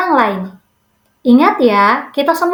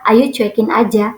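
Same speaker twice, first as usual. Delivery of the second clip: normal talking voice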